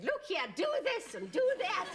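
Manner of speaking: high, loud laughing mock of a bossy trainer; a commanding trainer's voice, broken by laughter; Barbara Woodhouse. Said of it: "when I saw this lady saying".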